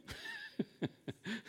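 soft high chuckle; small high laugh at yourself. Real, not acted. Preacher to congregation after admitting vulnerability, soft chuckle.

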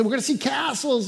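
high, loud, eager coaxing; dad selling the trip to his son, high and eager. Retold or imitated. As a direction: talk a toddler into the trip, bright and pleading.

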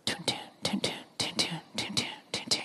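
soft whispered tun-tun of a heartbeat; mimicking a baby's heartbeat in a whisper. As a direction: breathe out the heartbeat sound. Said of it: "we heard the most beautiful sound".

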